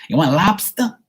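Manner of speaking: high, hammed-up Boston question; exaggerated Boston accent on lobster; hardcore Boston accent. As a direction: imitate a thick Boston accent, pitch up. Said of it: "They got that hardcore accent".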